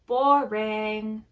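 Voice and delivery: loud, drawn-out heckle; yell. A narrator's reading. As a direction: yell it over the others, bored. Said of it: "Chuck yells over them".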